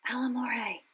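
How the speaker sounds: whispered spell. A narrator's reading. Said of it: "and whispered".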